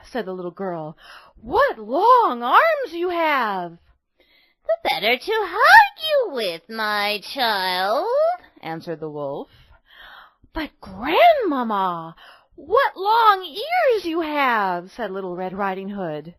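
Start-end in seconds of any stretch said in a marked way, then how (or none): 1.4-3.8 put-on high, slow amazed girl voice; surprised girl. A narrator's reading. Girl marvels. 4.7-8.1 wolf as granny. A narrator's reading. Wolf replies. 10.5-14.9 put-on high, slow amazed girl voice; surprised girl. A narrator's reading. Girl marvels.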